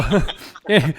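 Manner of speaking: a loud real burst of laughter; hearty laugh. Real, not acted. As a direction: laugh out loud at your own joke. Host laughs at own joke.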